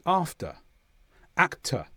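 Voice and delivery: "after", "actor" in southern British, ending on a bare uh. Shown as a demonstration. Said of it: "the r at the end of a word after a vowel is usually not pronounced".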